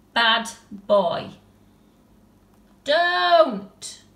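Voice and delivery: sharp, loud telling-off; scolding. Acted. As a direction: snap at a naughty pet. Tilly scolds the bear.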